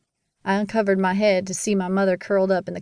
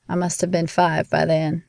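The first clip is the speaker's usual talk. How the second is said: usual speaking voice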